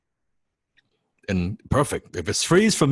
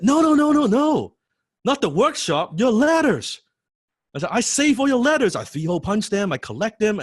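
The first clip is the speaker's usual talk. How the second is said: high, loud, eager correction; excited fanboy; 5 dB louder than usual. Retold or imitated. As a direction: pile it on like an overexcited fan. Eagerly correcting his idol.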